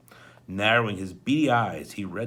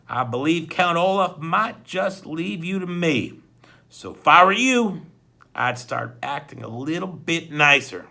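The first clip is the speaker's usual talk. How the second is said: smooth threat; 5 semitones higher, 7 dB louder than usual. A narrator's reading. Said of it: "said smoothingly".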